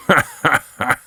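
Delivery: high, cackling monster laugh; brief taunting monster chuckle; mocking laugh. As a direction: laugh at the girl's anger. Monster mocks Nina.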